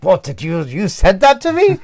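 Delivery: loud, high-pitched affronted outburst; hammed-up outrage in a strong English accent; acted English indignation. Mocking own acted reply to the colleague, English indignation. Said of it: "I have a very strong English accent".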